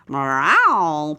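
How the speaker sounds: low, loud, slow cat's meow, purred; a flattering courtier purrs a meow. A narrator's reading. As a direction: purr it like a pampered cat. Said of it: "purred the most well-dressed".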